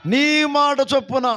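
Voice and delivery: drawn out, stress on మాట; slow emphatic phrase. Shown as a demonstration. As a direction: lead the crowd, leaning on మాట. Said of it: "గట్టిగా చెప్పండి". (మాట మీద ఒత్తిడి)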